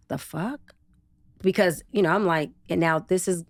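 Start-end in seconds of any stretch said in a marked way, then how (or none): 0.1-0.7 soft, baffled "the fuck?"; quietly taken aback; incredulous mutter. Retold or imitated. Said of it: "And I'm like".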